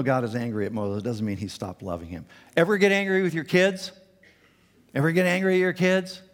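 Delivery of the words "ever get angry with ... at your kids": higher and louder; emphatic question. Real, not acted. Preacher questioning the congregation, loud and emphatic. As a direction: challenge the parents in the room.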